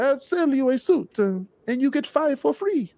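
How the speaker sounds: high-pitched mall salesman impression; mock suit salesman. Host imitates Fairlane store clerk pushing a suit deal, salesman voice. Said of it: "They be like".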